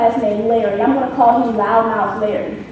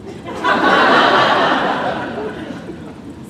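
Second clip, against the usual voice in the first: breathy laugh; 9 semitones higher than usual. Real, not acted. Laughs at braces punchline.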